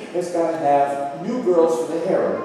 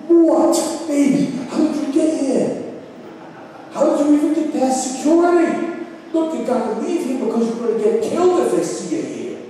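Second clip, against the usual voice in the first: fretful Esther hushing her cousin; alarmed young woman; 8 semitones higher than usual. Acted.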